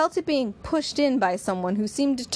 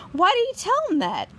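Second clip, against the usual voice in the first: much higher, louder voice of disbelief; bewildered protest; 12 semitones higher, 4 dB louder than usual. Acted. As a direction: Jan crying out at the betrayal. Jan confronts Bigger, bewildered.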